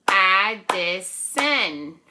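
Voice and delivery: Addison in three loud, slow beats; clap-along Addison, drawn out and punched; syllable by syllable. Shown as a demonstration. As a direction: break Addison into Ad-di-son, each part separate. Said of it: "three syllables".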